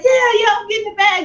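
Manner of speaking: high, breathless yes-yes; eager reassurance. Retold or imitated. Wife answering her husband about the money, giddy and high. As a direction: brush him off, thrilled.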